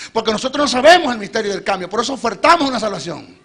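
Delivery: loud, climbing preacher's cry; preaching at full force. Acted. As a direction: press the crowd hard, rising in pitch. Predicación a pleno volumen. (predicación enérgica y fuerte)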